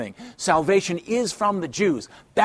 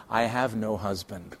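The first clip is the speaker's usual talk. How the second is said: much softer and lower, ashamed; hushed shameful confession; 5 semitones lower, 6 dB quieter than usual. Acted. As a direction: admit it quietly, eyes down. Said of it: "probably with some shame".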